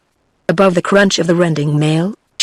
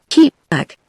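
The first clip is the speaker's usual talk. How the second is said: higher-pitched warning command; sharp order; 7 semitones higher than usual. A narrator's reading. Sharmurat orders the advancing stranger off, commanding. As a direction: order him back, pitch jumping up. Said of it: "ordered Sharmurat".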